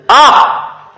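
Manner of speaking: loud, high acted "ah"; mock exclamation. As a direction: imitate a listener's sudden loud reaction. Acted reaction after his preaching.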